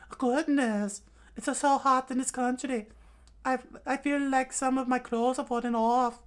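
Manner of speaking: put-on high girlish falsetto; put-on high feminine voice; Nami's voice. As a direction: coo coyly in falsetto, complaining of the heat. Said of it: "he actually spoke in Nami's voice".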